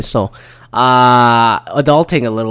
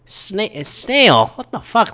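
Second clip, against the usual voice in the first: high, drawn-out mock disbelief; incredulous echo; 10 semitones higher, slower than usual. Him echoing the lady's odd word, incredulous. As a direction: repeat it slowly, high and baffled.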